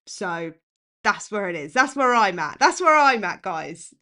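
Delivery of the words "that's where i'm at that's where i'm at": emphatic. Real, not acted. Emphatic repeated conclusion.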